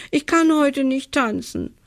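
high-pitched acted tearful wail; schluchzend. A narrator's reading. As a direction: whimper it high, as a narrated child in tears. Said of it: "schluchzt sie". (gespielt weinerlich, mit hoher Stimme)

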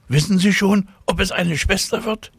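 put-on high, eager little girl voice. A narrator's reading. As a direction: ask it like an excited child. Tina asking eagerly.

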